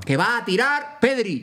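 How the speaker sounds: high, stretched-out football fan's plea; mock urgency. Husband to wife in labour during penalties, mock urgent. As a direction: imitate a fan desperate not to miss the kick.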